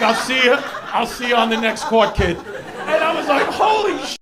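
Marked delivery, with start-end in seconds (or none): 0.0-2.4 loud, higher mock call; shouted farewell. Umpire taking leave of the court attendant, loud call. 3.5-4.2 high, loud mock astonishment; stunned exclamation. Court attendant reacting to the fake umpire, loud astonishment.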